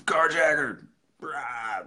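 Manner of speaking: put-on high, rowdy drunken holler; slur-happy drunk yelling into a voicemail. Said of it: "just drunken messages".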